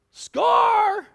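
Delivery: high, loud cheer of triumph; big whooping score; excited shout. Acted. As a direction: yell it like you just won big. Congregant cheering for late workers.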